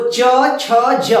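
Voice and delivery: च छ ज said slowly, tongue against the palate; palatal sound demo. Shown as a demonstration. Teacher slowly sounding च छ ज for the class. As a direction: draw out च छ ज so each one is heard. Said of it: "ये देखिए, कंठ से थोड़ा ऊपर से".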